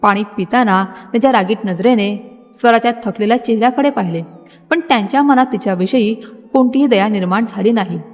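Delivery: plain reading voice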